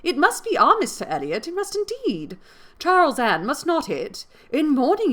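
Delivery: high, loud, insistent exclamations; Mary crying out in excitement; excited cry. A narrator's reading. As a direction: gush with breathless certainty, pitch leaping up. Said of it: "cried Mary".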